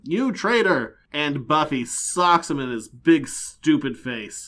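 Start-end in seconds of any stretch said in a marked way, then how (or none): 0.0-1.0 higher, louder accusing yell; angry shout. Acted. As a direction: yell it at a traitor. Demon shouts at betrayer.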